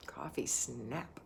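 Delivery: slow little whisper. Real, not acted. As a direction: breathe it out slowly, voiceless. Savouring her coffee aside.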